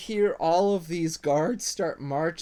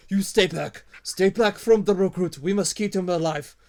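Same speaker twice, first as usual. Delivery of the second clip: higher, louder defiant threat; kobold warning; 5 semitones higher, 8 dB louder than usual. Acted. Kobold guarding the recruit from the party, loud warning. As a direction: hold them off with a dagger. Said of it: "and he says".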